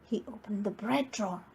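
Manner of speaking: usual speaking voice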